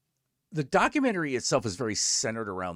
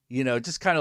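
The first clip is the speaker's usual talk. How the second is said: normal talking voice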